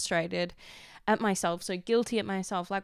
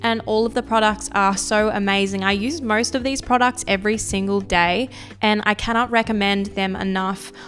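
loud, upbeat sponsor read over music; 8 dB louder than usual. Acted. Host reads the sponsor ad to listeners, bright ad voice. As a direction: sell the products like a radio host.

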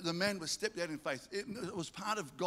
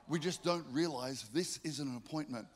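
ordinary delivery

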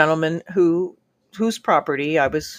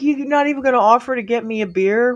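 quick, indignant demand, higher; 7 semitones higher, faster than usual. Retold or imitated. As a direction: fire off the question, offended. Confronting him about beer.

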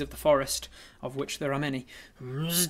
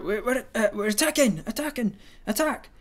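rising, higher battle cry; shouting; 6 semitones higher, 6 dB louder than usual. Acted. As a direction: urge everyone into the attack. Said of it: "we shout".

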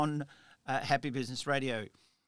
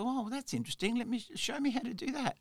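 put-on eager young self; 8 semitones higher, 4 dB quieter, faster than usual. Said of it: "I said".

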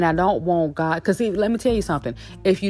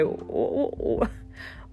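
mock bafflement; 4 semitones higher, 8 dB quieter, much slower than usual. Acts baffled reaction to God.

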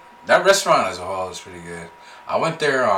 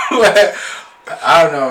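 slow, laughing, higher; 8 semitones higher, 7 dB louder, much slower than usual. Real, not acted. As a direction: let the laugh drag the words out. Laughing reply about Worcester.

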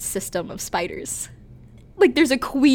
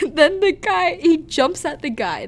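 high, louder, words broken by giggles; laughing; 7 semitones higher, 5 dB louder than usual. Real, not acted. Telling co-host the spider jumps, laughing. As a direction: push the story out through laughter.

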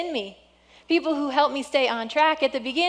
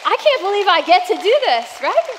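higher and louder, bursting with joy; excited disbelief; 5 semitones higher, 8 dB louder than usual. Real, not acted. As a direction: let the joy burst out loud. Pastor thanks her church.

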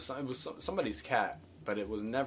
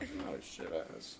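lower, unvoiced mock protest; whiny Carson, whispered; 6 semitones lower, 6 dB quieter than usual. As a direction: whine it under your breath like Carson. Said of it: "he was like".

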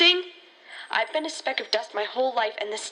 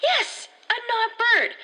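high, slow and emphatic, spelling it out; stretched, pitched-up yes of frustration; exasperated insistence; 12 semitones higher, slower than usual. Acted. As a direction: insist on it like she's talking to a wall. Insisting the creature is real.

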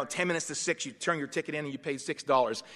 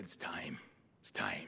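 quiet signal; 10 semitones lower, 11 dB quieter, slower than usual. Retold or imitated. Captain quietly signals the boys.

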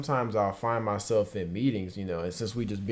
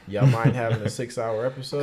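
ordinary talk, nothing marked

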